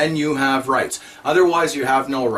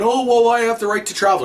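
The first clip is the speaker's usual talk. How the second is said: higher, sing-song mock of a complainer; mocking whiny quote; 7 semitones higher, 3 dB louder than usual. Mocking the people writing him about travel rights, whiny sing-song. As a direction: parody the entitled letter-writer.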